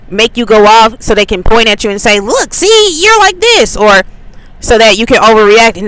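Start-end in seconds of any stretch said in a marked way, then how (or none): 2.2-3.8 high, sing-song jeer in a mocking voice; mocking taunt. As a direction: mimic a smug needler pointing at you.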